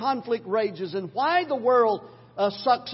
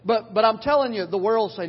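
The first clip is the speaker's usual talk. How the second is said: ordinary delivery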